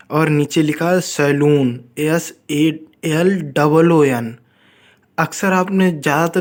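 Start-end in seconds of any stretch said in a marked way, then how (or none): 2.0-4.4 slow spelling. Shown as a demonstration. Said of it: "spelling it out slowly".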